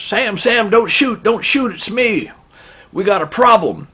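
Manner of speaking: high, loud pleading shout; faked panic. Russell calling to Sam from the bush in faked panic. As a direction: sell the fake emergency, urgent and pleading.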